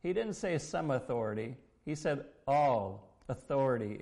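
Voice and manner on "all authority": "All" hit louder and set apart; stress on All. Retold or imitated. Preacher correcting "some authority", stress on "All". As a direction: punch the word "All".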